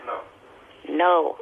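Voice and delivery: ordinary talk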